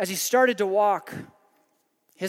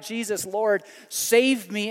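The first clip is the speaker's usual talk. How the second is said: loud desperate cry; 4 semitones higher, 4 dB louder, slower than usual. Acted. Peter sinking, cries out.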